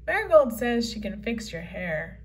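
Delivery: ordinary talk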